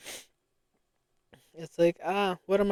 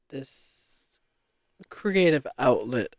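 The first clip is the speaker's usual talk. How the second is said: ordinary talk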